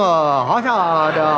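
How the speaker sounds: slow, drawn-out mock Japanese gasp; Japanese crowd exclaiming. Japanese lobby crowd gasping at naked man. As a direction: stretch it out like a shocked crowd. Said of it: "a couple hundred Japanese go".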